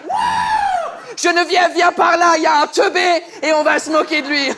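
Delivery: high, loud whoop into a shouted summons; gleeful calling out. Acted. Baker calling Geneviève to mock him, gleeful shout. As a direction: holler for a colleague to come and jeer.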